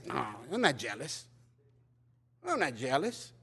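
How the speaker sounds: low, slow sulky denial; mock jealous denial. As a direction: play the jealous sister denying it. Mocking a jealous sister's denial.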